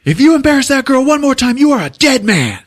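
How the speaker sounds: high, loud, rapid threat; imitated screaming match. DP threatens the director over the actress, screaming. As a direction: hurl it out fast at full pitch. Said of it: "big fucking screaming match".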